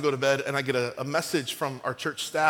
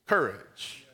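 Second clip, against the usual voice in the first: ordinary delivery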